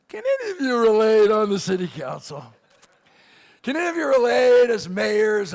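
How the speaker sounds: loud, stretched-out comic wail; anguished appeal. Acted. Speaker begging council members and mayors to relate, loud wail. Wails the question to fellow officials.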